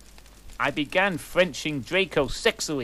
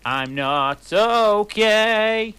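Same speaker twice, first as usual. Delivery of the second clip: high, loud and very slow falsetto wail; mock anguished cry, high and loud; hammed-up depressed scream; 9 semitones higher, 9 dB louder, much slower than usual. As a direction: drag it out like a melodramatic emo. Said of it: "he screamed depressedly".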